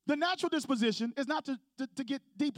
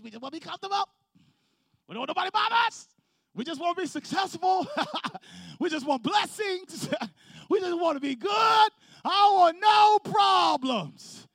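rising to a loud, high mock shout; laughing, then shouting the wish for no problems; mock complaint; 6 semitones higher, 7 dB louder than usual. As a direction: ham up the spoiled churchgoer's wants. Voicing the congregation's wants, mockingly.

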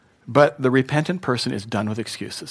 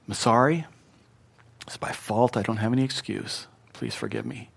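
very soft, lowered plea; sincere apology; 3 semitones lower, 7 dB quieter than usual. Shown as a demonstration. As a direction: confess the fault humbly and quietly. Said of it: "if it's sincere".

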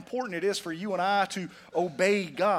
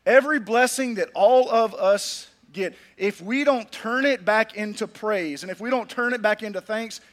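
ordinary talk

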